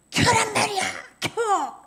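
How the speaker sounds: loud high furious order; angry demand. Retold or imitated. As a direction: bark the command at him. Woman demands music back on.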